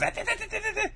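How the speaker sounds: high, rapid-fire stuttering monkey noise; mock shriek, a very high "da-da-da" run; imitated monkey shriek.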